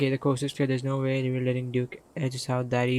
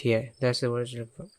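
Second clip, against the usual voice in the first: normal talking voice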